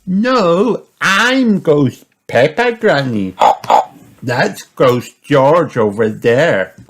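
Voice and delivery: loud, high little-pig correction; Peppa. A narrator's reading. Peppa telling Granny which ghost is which, high loud voice. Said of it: "pointing and giggling".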